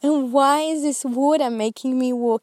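high, loud, exasperated plea to God. Retold or imitated. A woman retelling her confused prayer on the hike, exasperated and loud. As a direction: voice climbing in disbelief, pleading.

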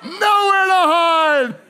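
loud, high, slow cry; dramatic shout. Real, not acted. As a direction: belt it out like there's no escape. Exclaimed dramatic punchline.

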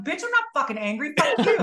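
answering rude comments with a fake furious comeback; mock angry retort. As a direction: clap back at a hater, hammed up. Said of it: "And I'm like".

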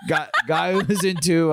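ordinary talk, nothing marked

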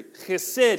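chesed with a rough throaty kh sound; Hebrew chesed. Shown as a demonstration. Congregation saying chesed together, throaty kh. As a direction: scrape the kh at the start of chesed. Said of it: "we say that together".

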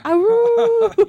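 high loud howl; jackal howl. Retold or imitated. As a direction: howl like a wolf. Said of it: "you actually hear".

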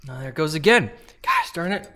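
burn flaring back up; wincing through spice pain; mouth burning from spicy food. Real, not acted. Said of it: "I can't talk at all".

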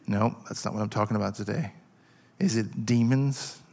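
usual speaking voice

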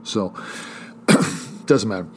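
ordinary delivery

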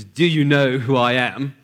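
slow, mock-indignant naval officer; highly offended. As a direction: draw it out as an offended officer pulling rank. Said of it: "naval officer got highly offended".

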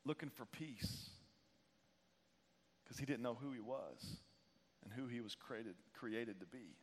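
hushed, low voice with long pauses; quiet, grave confession and appeal; solemn, heartfelt preaching. Real, not acted. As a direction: speak gently into the silence, weighing each phrase. Grave appeal, father's death.

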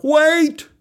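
high, drawn call; high-pitched call for a halt; yelled plea. Acted. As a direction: yell out to stop everything. Boy yelling to halt countdown.

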